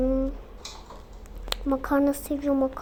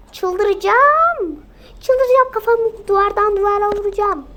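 higher, louder, at the end of her rope; mother yelling she'll go crazy; furious shout; 7 semitones higher, 7 dB louder than usual. Acted. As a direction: scream it out, going mad with the kid. Exasperated mother losing her temper.

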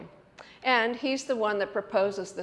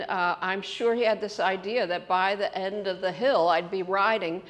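normal talking voice

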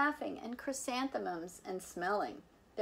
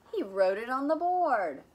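plain reading voice

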